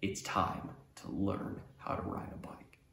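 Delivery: whispery, mock-momentous delivery; hushed, solemn announcement. Older brother insisting on the bike lesson, hushed and solemn. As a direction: deliver it softly like a grave decree.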